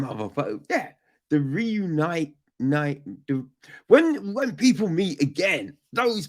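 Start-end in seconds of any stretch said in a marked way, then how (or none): none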